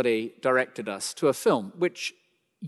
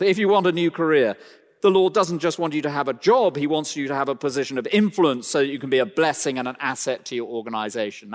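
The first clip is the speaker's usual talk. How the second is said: mock American accent; 2 semitones higher, 4 dB louder, faster than usual. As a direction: ham up an American prosperity preacher. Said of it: "That's my American accent".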